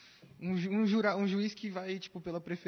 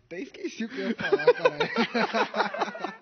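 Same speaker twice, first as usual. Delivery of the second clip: laughing; 13 semitones higher, 11 dB louder, slower than usual. Real, not acted. Laughing after friends tease him.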